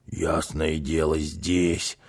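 low, soft crook's voice; Rulle the burglar. A narrator's reading. Second burglar points his partner to the drawers, low and soft. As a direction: drop low and answer under your breath. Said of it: "отозвался Рулли".

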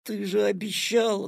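put-on high boyish protest; reproachful reminder. A narrator's reading. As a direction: hold them to their promise. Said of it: "напомнил Петя".

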